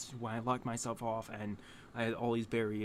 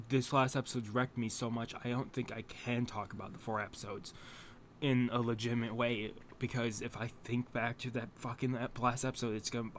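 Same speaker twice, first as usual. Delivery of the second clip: ordinary talk, nothing marked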